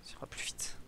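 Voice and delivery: hushed unvoiced whisper while sneaking. Real, not acted. Sneaking past guards in a forbidden zone, whispering. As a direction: whisper so the guards won't hear.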